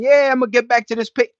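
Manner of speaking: high, loud cocky voice; mock hopeful deportee. Streamer voicing a deported artist, high and cocky. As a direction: play the guy sure he's heading home. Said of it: "you thinking".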